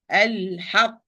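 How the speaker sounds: "Al-haq" stressed into a stopped final q; nabr on qaf. Shown as a demonstration. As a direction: push the sound just before the q of "Al-haq". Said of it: "This is the nabr".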